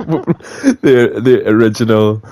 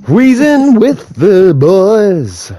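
drawn-out announcement; 7 semitones higher, 3 dB louder, much slower than usual. Real, not acted. Calling out a show title.

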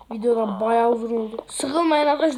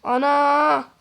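alarmed cry; 5 dB louder than usual. Real, not acted. Night falls, zombies come.

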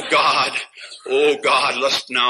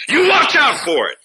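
sudden high, loud warning shout; sharp warning; 11 semitones higher, 5 dB louder than usual. Real, not acted. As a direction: fire the warning out at the crowd. Preacher warning the congregation.

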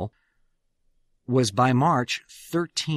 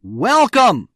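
loud, high, ringing call of welcome; shouted welcome; 8 semitones higher, 9 dB louder than usual. Retold or imitated. Samoset calling out to the wary Pilgrims, loud hailing shout. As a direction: call it out big and bright. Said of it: "shouted out in perfect English".

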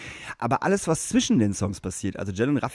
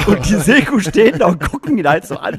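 ordinary delivery

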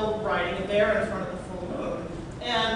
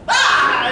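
bear roar; 5 semitones higher, 12 dB louder than usual. Retold or imitated. Voicing the bear chasing trolls.

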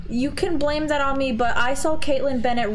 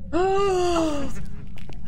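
shocked gasp; 6 semitones higher than usual. Acted. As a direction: gasp like you've been wronged. Mock offense at prank.

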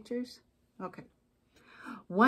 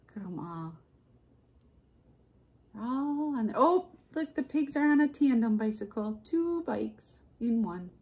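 usual speaking voice

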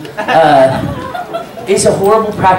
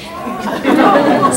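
a high unvoiced burst of laughter; high breathy laugh; 9 semitones higher than usual. Real, not acted. Laughing at the story of the lost luggage.

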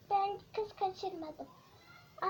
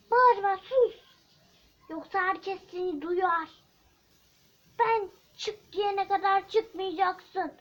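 shouting back and forth, louder; loud quarrel; 6 semitones higher, 9 dB louder than usual. Acted. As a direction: fight it out, both yelling. Kidnapper and girl quarrel.

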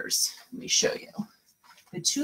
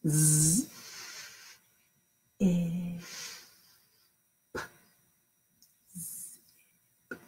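slow sounding out; 9 dB quieter, much slower than usual. Shown as a demonstration. Teacher sounding out zip slowly for the children. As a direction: slowly split Z, eh, p, then blend into zip. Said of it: "I wanna hear you".

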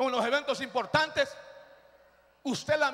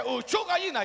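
raised voice shooing a hen; 3 semitones higher than usual. Retold or imitated. Boy to the tied hen, shooing it off. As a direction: shoo the bird along.

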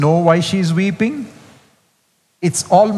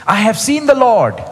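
lifted, eager proclamation; mild joyful announcement; 7 semitones higher, 3 dB louder than usual. Acted. Mary telling the disciples, lightly excited. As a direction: announce the news with a lift.

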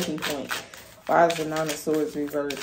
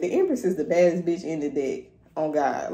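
usual speaking voice

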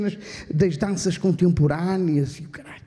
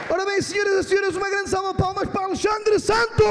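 announcer intro; 9 semitones higher than usual. Real, not acted. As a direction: hype up the crowd for the next act. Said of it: "announcing the next comedian".

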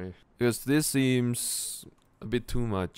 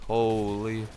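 ordinary talk

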